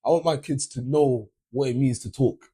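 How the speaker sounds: ordinary talk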